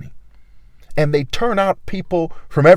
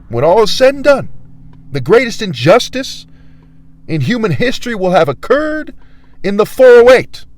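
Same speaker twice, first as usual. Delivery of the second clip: higher and louder, preaching doom; loud proclamation; 7 semitones higher, 6 dB louder than usual. Real, not acted. Warning the whole city of its injustice, proclaiming loudly. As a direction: thunder it like a prophet.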